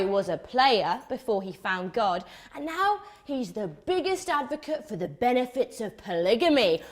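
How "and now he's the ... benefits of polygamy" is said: ordinary delivery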